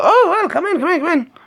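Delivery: very high falsetto squawk; Python mother. Retold or imitated. Acting out the Brian manger scene, high falsetto. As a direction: play the suspicious mum at the door.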